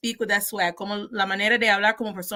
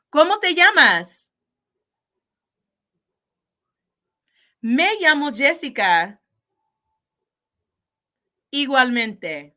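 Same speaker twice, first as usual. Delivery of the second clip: model dialogue; 3 semitones higher, 7 dB louder than usual. Shown as a demonstration. Teacher to students, practice dialogue, loud clear modelling. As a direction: call the question out to the class, slow and clear on the reply. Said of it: "I will ask you the questions".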